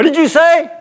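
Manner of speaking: high, challenging umpire question; mock yelling. Umpire confronts the mouthy pitcher, mock-angry challenge. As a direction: imitate an umpire taking offense. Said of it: "we're yelling".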